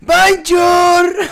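high, loud, long-held shout; over-the-top stage call; hammed-up shouted greeting. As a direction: belt out a silly hello to the room. Mock greeting to a silent crowd.

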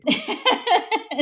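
short real squeal of laughter; high-pitched laugh. Real, not acted. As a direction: burst out laughing, high and loud. Laughs at own joke.

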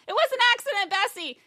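high loud apologetic cry; pleading wail. Acted. Farm woman pleading with her dead cow's ghost, high loud wail. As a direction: beg the cow's ghost for forgiveness. Mildred wails to ghost cow.

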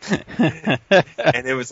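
ordinary delivery

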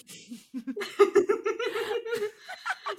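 delighted high-pitched laugh. Real, not acted. Gloating over her comeback, high delighted laugh.

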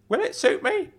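high, cheery uncle voice; bright. A narrator's reading. Uncle jokes about putting the kettle on, brightly. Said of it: "said Claude brightly".

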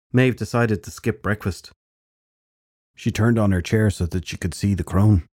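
plain reading voice

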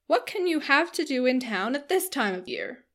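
astonished matron character voice; incredulous matron. A narrator's reading. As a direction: question it like a scandalised busybody. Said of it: "cried Missus Jennings".

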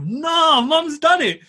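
high-pitched comic dismay; groaning to yourself, pitched right up; dismayed disbelief. Retold or imitated. Son thinking to himself as mum rings agent, dismayed. Son's dismay at mum's call.

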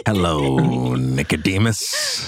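low, slow demonic growl. Acted. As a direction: drag the name out like a demon. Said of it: "[name]'s demon voice".

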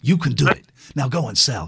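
low, pushy pep-talk voice; a deep hype-up coach voice; motivational speaker. Retold or imitated. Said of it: "motivational speaker voice".

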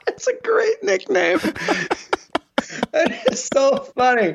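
high-pitched, laughing through the words; helpless laughter. Real, not acted. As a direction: barely get it out for laughing.

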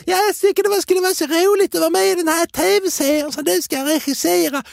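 high put-on Scanian accent. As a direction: gush in an exaggerated Scanian accent. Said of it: "så börjar hon göra skånska så här". (överdriven skånska med ljus röst)

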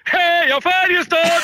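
put-on high, slow Stockholm-accent shout. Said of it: "lite högre".

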